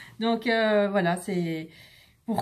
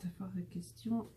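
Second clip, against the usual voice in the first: whispered under her breath; whispering; 2 semitones lower, 15 dB quieter than usual. Real, not acted. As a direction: whisper it to yourself. Reading her notes to herself.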